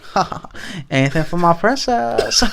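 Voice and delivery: slow, soft impression with odd laugh; Tommy Wiseau. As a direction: drawl it in a hammy foreign accent. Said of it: "I was doing my Tommy Wiseau voice".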